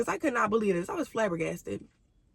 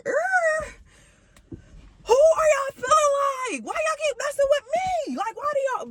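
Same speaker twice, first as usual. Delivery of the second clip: much higher, crying shout of disbelief; high crying outburst; 4 dB louder than usual. Retold or imitated. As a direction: wail it out, high and hurt, like a cry. Outburst over classmate's betrayal.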